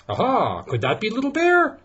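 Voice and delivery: high, bright aha of discovery; spring up high on the aha; excited discovery. Acted. Papa Bear thinks he found the cub, high excited aha.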